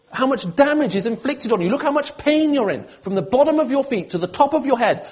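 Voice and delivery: high, urgent plea pressing on each phrase; impassioned pleading. Acted. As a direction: plead as God with a hurting people. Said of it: "God is pleading with his people here".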